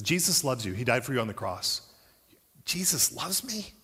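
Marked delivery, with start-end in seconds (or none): 2.6-3.7 higher, softer, slow amazed question. Shown as a demonstration. Mature believer hearing the gospel, slow, amazed. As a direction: marvel at it quietly. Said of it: "a mature response is".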